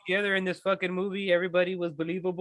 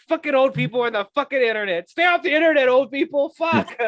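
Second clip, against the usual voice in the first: higher and louder, venting at the screen; a viewer yelling at old people to stay offline, loud and exasperated; exasperated rant; 5 semitones higher, 5 dB louder than usual. Retold or imitated. Ranting at old people online.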